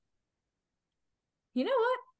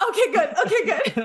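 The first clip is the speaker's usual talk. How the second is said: laughing; 6 semitones higher, 5 dB louder than usual. Real, not acted. Laughs while sealing promise.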